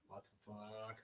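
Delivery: dazed whisper of disbelief; stunned hushed. Retold or imitated. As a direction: breathe it out in disbelief.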